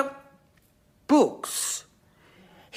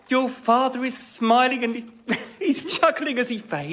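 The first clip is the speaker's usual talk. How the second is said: ordinary talk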